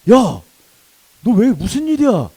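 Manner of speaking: acted big shout of concern; alarmed shout. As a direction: call out in alarm to a beaten friend. Older friend finds him beaten.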